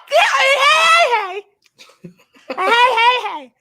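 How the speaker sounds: high, loud laughing heys; real joyful shouting; excited cheer. Real, not acted. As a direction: chant hey in glee. Cheering hey hey.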